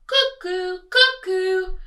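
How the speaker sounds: loud, high two-note cuckoo; sing-song bird call from a clock. A narrator's reading. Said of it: "went the living room clock".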